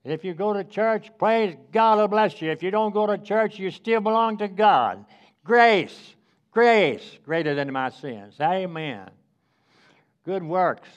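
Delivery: loud, ringing pulpit preaching; full-voiced revival preacher, stretching key words. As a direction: preach it out to the congregation. Opening preaching on grace.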